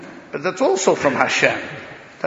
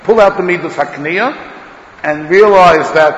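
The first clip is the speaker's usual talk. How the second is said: urgent exhortation; 3 semitones higher, 10 dB louder than usual. Acted. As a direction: call it out to the room. Preacher urging the listeners.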